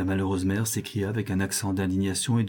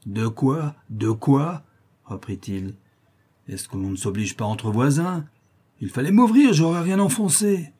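a hint of the coarse drunk, flaring higher and louder; grumble, then flare up; mildly acted drunk neighbour feigning innocence; lightly voiced drunk brute; 5 semitones higher, 5 dB louder than usual.

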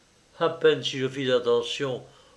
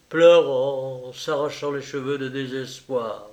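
ordinary delivery, nothing marked

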